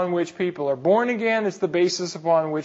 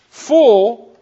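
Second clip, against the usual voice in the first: full held long, higher and louder; stress on full; 4 semitones higher, 8 dB louder than usual. Shown as a demonstration. Pastor teaching the flock, stressing full. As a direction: lean hard on the word full.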